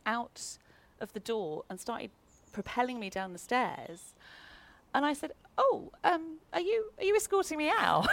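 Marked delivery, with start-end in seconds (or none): 5.6-8.1 higher, bright disbelieving question; surprised question. Retold or imitated. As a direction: retell your startled, innocent surprise. Escorted out by the agent.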